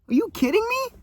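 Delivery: very high disbelieving question; real amazement at the money; incredulous. Real, not acted. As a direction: let the disbelief leap up. Amazed at making a year's pay.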